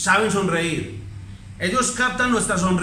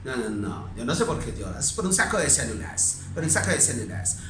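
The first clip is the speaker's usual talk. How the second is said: much lower, softer dismissive voice; mocking callous doctor; 8 semitones lower, 7 dB quieter than usual. Doctor coldly dismissing a crying mother, low and soft. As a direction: brush off the grieving mother coldly. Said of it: "y le dice".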